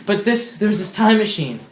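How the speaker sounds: ordinary delivery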